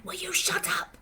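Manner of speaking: high, strained telling-off; mock exasperated mum. Owner snapping at her barking dog, high and strained. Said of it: "I'm saying".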